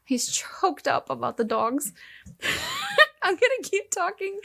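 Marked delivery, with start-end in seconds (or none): 2.4-4.5 high laughing through words. Real, not acted. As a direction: crack up while talking. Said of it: "Keep going".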